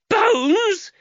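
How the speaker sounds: very high, loud shocked cry; mother shrieks at her son. Acted. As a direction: shriek the word in alarm. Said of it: "Giacchetta Cagowan shrieked".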